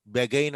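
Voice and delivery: బెగైన్ as be-gain, e in the first syllable; wrong spelling pronunciation. Shown as a demonstration. Teacher testing a misreading from the spelling, wrong pronunciation. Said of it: "అనం".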